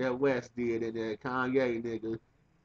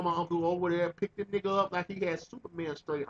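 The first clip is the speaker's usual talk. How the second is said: ordinary delivery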